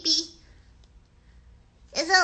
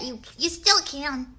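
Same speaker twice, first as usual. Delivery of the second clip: normal talking voice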